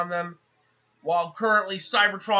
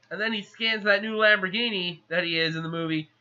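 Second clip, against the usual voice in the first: ordinary talk, nothing marked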